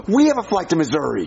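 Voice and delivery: a sharp gasp, then a high, thrilled line; gasping surprise. Real, not acted. [name] hears the pilot's destination, gasping surprise. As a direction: gasp and blurt it out in delight.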